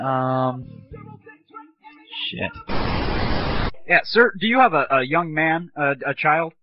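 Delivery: ordinary talk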